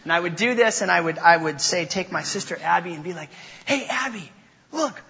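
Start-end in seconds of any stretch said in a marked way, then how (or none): 3.7-5.0 mock distraction ploy. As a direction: lure a little sister into looking away. Tricking little sister with a distraction.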